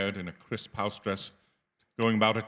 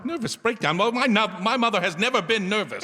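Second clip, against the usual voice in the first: much higher, louder pleading denial; frightened disbelief; 10 semitones higher, 3 dB louder than usual. Acted. Son refusing to believe what the stranger tells him. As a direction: push back against the news in panic.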